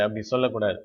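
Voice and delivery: ordinary delivery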